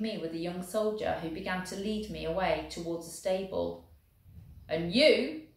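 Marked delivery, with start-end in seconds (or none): 4.7-5.5 loud, higher sergeant's shout; booming call. Acted. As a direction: boom it across the stable yard. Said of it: "came that booming voice again".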